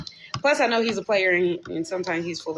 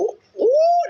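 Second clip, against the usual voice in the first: sudden high squeal; real yelp as juice bursts out; startled high-pitched ooh; surprised squeal; 8 dB louder than usual. Real, not acted. Juicy fruit bursting on her.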